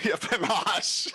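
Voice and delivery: laughing through the word; amused echo of a player's joke; chuckling agreement. Real, not acted.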